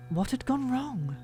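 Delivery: usual speaking voice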